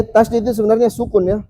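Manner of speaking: ordinary talk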